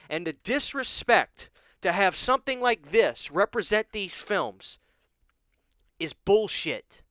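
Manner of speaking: upset outburst. Real, not acted. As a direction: let the frustration boil over. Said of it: "I didn't mean to get upset".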